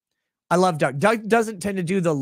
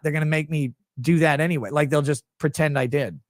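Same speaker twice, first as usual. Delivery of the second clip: ordinary talk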